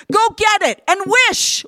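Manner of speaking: high, loud command; frantic order. A narrator's reading. Wife orders her husband to wish, high and loud. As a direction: order him with desperate force. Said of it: "ordered Erda".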